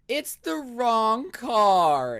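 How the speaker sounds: high, loud, drawn-out imitated wail; mock crying shriek. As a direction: wail it slowly like a spoiled brat having a tantrum. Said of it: "a shriek, a cry".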